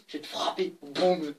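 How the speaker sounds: mock threat in a put-on voice, then a loud boum; imitating Victor. Kid imitating classmate Victor threatening him, mock threat. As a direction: lightly play the classmate threatening to hit. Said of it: "Moi, je te fais une imitation".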